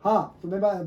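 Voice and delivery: ordinary talk, nothing marked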